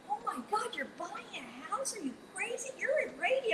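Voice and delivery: high, mocking disbelief; mock incredulous friends. Friends teasing the young DJ, high mock disbelief. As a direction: squeal it like shocked friends.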